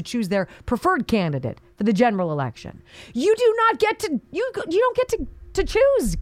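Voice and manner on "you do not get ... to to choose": mock elitist. Mocking party elites' decree.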